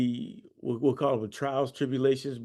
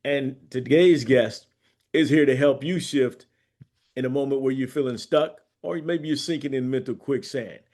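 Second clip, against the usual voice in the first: loud, fast announcer read; radio host intro; 7 dB louder than usual. Acted. Host welcoming the guest to the show, in a booming announcer voice.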